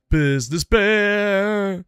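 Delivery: business bear voice. Shown as a demonstration. To the audience about his wife, a slow silly voice. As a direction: stretch it out like a cartoon bear. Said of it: "She's business bear".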